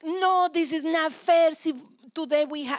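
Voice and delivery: put-on a child protesting unfair dishes, loud and whiny; whiny child complaint. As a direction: whine like a child protesting chores. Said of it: "they're like".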